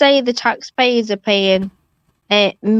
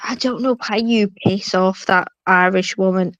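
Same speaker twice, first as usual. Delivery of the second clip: ordinary talk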